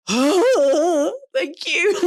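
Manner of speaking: loud, high, choked-up thanks; tearful thank you. Retold or imitated. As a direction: say it overwhelmed, near tears. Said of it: "and I was like".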